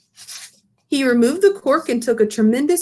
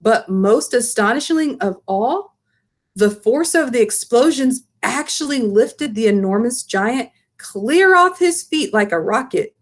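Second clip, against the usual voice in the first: ordinary delivery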